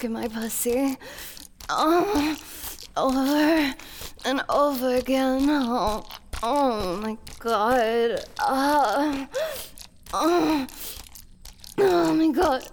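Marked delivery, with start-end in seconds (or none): 1.7-12.7 high, loud moaning delivery; mounting passion. Acted. Princess overcome with her lover, rising passionate moans. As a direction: gasp the words out as passion builds.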